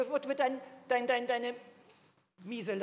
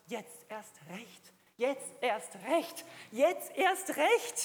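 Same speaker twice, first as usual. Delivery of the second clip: growing defiance; much slower than usual. Retold or imitated. Preacher retelling his vow to God, defiance building.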